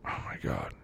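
breathy, voiceless exasperation; exasperated whisper. Acted. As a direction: whisper it in disbelief. Exasperated during argument.

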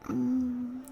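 ordinary delivery

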